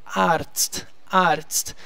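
Arzt ending in a crisp T-S-T; careful Arzt with every final consonant heard; pronunciation demo. Shown as a demonstration. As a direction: model Arzt twice, evenly, with a pause between. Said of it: "T Z tem som de T S T".

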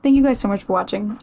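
ordinary delivery

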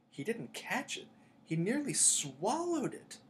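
high, drawn-out wail of complaint; howling protest. A narrator's reading. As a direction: howl it high in outraged protest. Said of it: "Flint was still howling".

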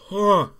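loud high gasp; sharp gasp. Real, not acted. Gasp at the burn.

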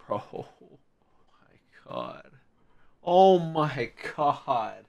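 ordinary talk, nothing marked

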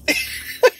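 breathless high-pitched giggle. Real, not acted. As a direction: giggle at your own joke. Giggling after joke about likes.

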